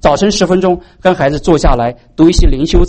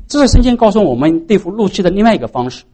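ordinary talk

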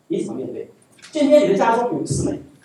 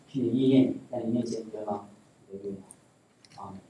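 prayer; 9 semitones lower than usual. Acted. Preacher leads a closing prayer.

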